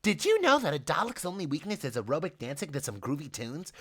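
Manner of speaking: chirpy, upbeat man voicing; bubbly host voice, light and sing-song; cheerful high man's voice. Richard's voice held.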